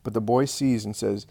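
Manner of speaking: ordinary delivery, nothing marked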